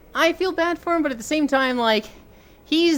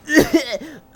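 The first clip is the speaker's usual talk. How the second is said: burst of laughter; 10 semitones higher, 4 dB louder than usual. Real, not acted. Laughing while retelling the fight.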